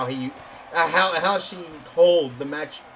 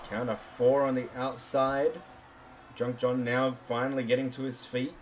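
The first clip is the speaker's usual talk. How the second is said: wrestling commentator; 4 semitones lower, 8 dB quieter than usual. Acted.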